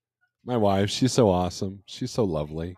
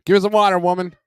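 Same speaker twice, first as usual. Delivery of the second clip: higher, louder holler to his wife; raised-voice demand for water; mock demand; 8 semitones higher, 8 dB louder, faster than usual. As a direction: call out a mock-bossy order across the house.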